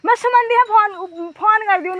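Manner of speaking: loud, high call to a distant relative. Real, not acted. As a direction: pitch it up and call across the fields. Calling out to someone far away.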